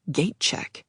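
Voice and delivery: stunned whisper; whispered alarm. A narrator's reading. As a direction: breathe it out in shock. Shocked inner reaction.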